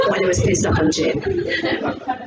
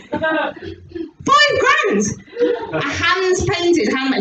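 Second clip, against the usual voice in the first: higher-pitched outrage at the price; indignant husband; 5 semitones higher, much slower than usual. Retold or imitated. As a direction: imitate an aggrieved husband quoting costs. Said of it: "I was so annoyed".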